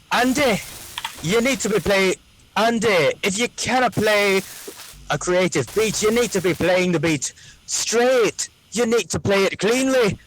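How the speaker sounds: high, hammed-up Scottish accent; terrible Scottish accent. Teasing the drummer about the beat, terrible Scottish accent. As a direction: lecture him in a bad Scottish accent. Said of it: "using these terrible Scottish accents".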